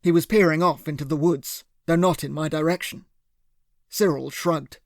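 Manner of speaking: usual speaking voice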